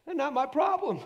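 high, loud gleeful dismissal; carefree shout. Acted. Preacher acts leaving worries with God, carefree shout. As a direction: toss the burden off with a shout.

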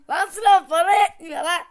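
yelling in exasperation; frustrated shout. Real, not acted. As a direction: shout it out, fed up. Yelling back at the car.

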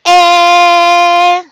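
"e-" held long on a level pitch; sounding out. Shown as a demonstration. Teacher sounding out a word for pupils, long level vowel. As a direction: hold the vowel "e-" by itself.

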